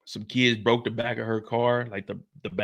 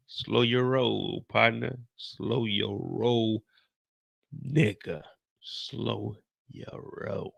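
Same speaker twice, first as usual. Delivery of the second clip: slow fading warning; 6 dB quieter, much slower than usual. Retold or imitated. Brother repeating slowly, fading.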